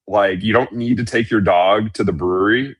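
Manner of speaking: ordinary delivery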